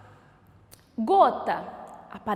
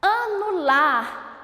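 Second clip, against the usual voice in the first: ANULAR said slowly, higher; slow word presentation; 5 semitones higher, much slower than usual. Shown as a demonstration. Teacher introduces a word, ANULAR slow and raised. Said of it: "quantas sílabas tem?".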